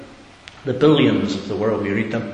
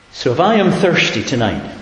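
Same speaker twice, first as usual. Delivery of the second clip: I am thirsty tonight, pitched up and louder; louder, higher plea; 6 semitones higher, 6 dB louder than usual. Real, not acted. Preacher voicing the needy listener, louder and higher. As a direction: lift the plea high and strong.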